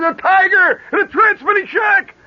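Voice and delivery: loud high alarmed yell, retold with gusto; retold holler. Soldier retelling how he alerted the barracks about the tiger, hollering.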